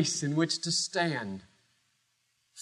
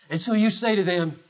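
normal talking voice

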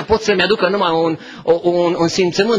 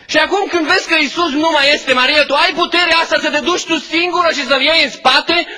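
rising amazement; 8 semitones higher than usual. Real, not acted. Preacher asks Mary where she found the strength, building to amazed. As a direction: marvel at her strength, building up.

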